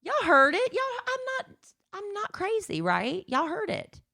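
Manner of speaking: high-pitched playful protest; rally the listeners as witnesses, voice way up; exaggerated pleading for backup, high; mock indignant appeal. Protesting to listeners she isn't crazy.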